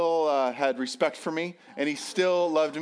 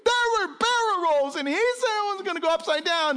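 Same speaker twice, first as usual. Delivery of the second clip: put-on very high, wailing child's complaint; mock crying kid telling on dad; tearful tattling; 13 semitones higher, 3 dB louder than usual. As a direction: whine it high to mom. Upset son tattling to his mother.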